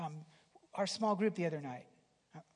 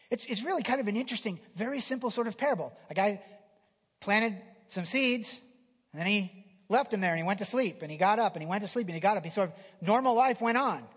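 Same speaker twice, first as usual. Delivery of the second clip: louder, quick storytelling sermon; preaching with energy; 3 semitones higher, 6 dB louder than usual. Real, not acted. Preacher retelling the parable to congregation, energetic. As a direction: drive the parable home to them.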